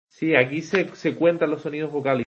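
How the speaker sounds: ordinary talk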